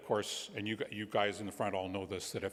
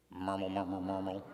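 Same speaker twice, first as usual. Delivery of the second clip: put-on baby babbling. A narrator's reading. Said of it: "And the baby goes".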